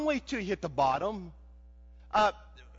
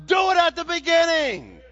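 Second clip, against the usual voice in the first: urgent shout; 8 semitones higher, 7 dB louder than usual. Real, not acted. Preacher urging at full force.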